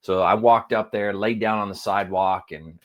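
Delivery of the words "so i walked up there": ordinary talk, nothing marked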